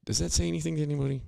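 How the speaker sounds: exasperated. Real, not acted. Rhetorical outburst at the audience.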